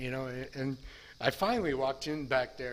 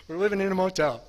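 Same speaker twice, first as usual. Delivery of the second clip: "motel" said higher and much louder, accusing; kids' loud complaint; 6 semitones higher, 11 dB louder, faster than usual. Retold or imitated. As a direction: blurt it out like a resentful kid. Kids complaining about the motel.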